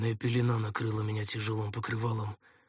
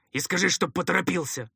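raised, loud gruff order; commanding call into the distance; calling after someone; 8 semitones higher, 6 dB louder than usual. A narrator's reading. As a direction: shout it after a departing helper. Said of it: "крикнул ему вдогонку второй".

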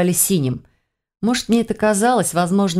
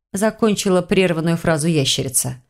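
plain reading voice

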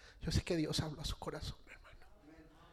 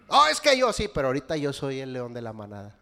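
cocky son; 11 dB louder than usual. Retold or imitated. Pastor imitates his son boasting to him, loud and cocky. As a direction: brag like a teen who thinks he rules.

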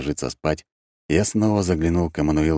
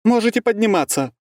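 high shout from upstairs; calling out; 4 dB louder than usual. A narrator's reading. Марьяна summons the others to the attic, shouting. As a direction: call down to everyone, pitched high. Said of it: "раздался крик".

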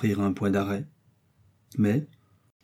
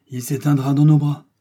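higher, louder note of despair; 4 semitones higher, 6 dB louder than usual. A narrator's reading. Doctor on the dying priest, despairing. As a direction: voice rises in mild despair. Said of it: "dit le docteur avec désespoir".

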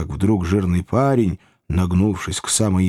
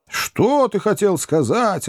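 much higher, slow eager question; many voices asking; 10 semitones higher, slower than usual. A narrator's reading. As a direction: everyone asks at once. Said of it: "раздались голоса". (хор удивлённых голосов)

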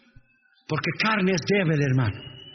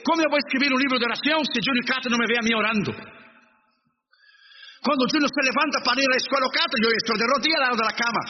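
fast, higher, urgent sermon; fast fervent preaching; 4 semitones higher, faster than usual. Acted. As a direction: drive it home quickly. Predica rápido y alto. (predicación rápida y ferviente)

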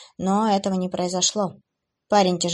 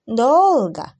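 drawn out; 4 semitones higher, 6 dB louder than usual. Acted. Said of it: "протянула Севиль".

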